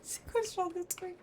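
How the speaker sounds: sharp gasp, then high startled question; startled gasp, voice jumping up; scared horror game streamer. Real, not acted.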